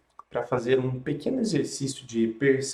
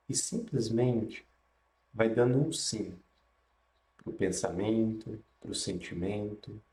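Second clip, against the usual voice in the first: guided meditation; 3 dB quieter than usual. Acted.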